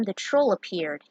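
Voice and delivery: plain reading voice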